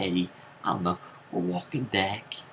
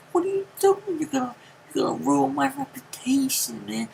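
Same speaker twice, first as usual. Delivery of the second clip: much higher, louder mortified pleading; embarrassed protest; 4 dB louder than usual. Retold or imitated. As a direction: beg him to stop, embarrassed. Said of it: "I'm like".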